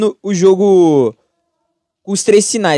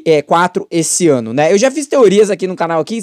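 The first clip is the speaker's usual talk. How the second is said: ordinary talk, nothing marked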